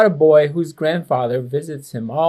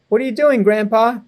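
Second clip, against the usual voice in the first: put-on higher, curious boy; child voice; 7 semitones higher, 4 dB louder, faster than usual. Boy calling to grandpa in the garden, high and curious. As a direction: lift it up like an eager kid.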